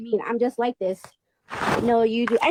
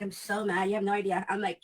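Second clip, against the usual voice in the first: ordinary delivery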